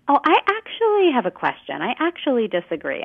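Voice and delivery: high, startled actually; falsely surprised. Shown as a demonstration. Coach demonstrating a hedge: mock surprise on actually. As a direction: act surprised at your own question. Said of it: "Actually makes it sound like you're feeling surprised".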